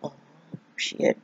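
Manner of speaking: breathless whispered "oh shit"; barely voiced dread at the deep end; whispered under the breath, scared; whispered panic. Terrified before jumping in.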